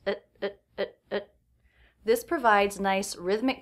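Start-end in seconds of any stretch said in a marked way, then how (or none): 0.1-1.3 quick, light "It" fired off; very fast reduced at. Shown as a demonstration. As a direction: rattle off "it" in a blink. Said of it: "it's very fast".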